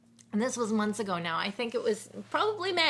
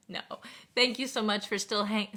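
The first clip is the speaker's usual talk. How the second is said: ordinary talk, nothing marked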